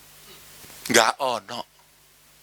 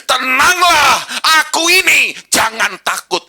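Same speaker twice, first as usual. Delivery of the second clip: high, loud proclamation; commanding call; 12 semitones higher, 8 dB louder than usual. Acted. Jesus calls to frightened disciples, loud and commanding. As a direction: call it out boldly over the storm. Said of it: "ini perkataan Yesus".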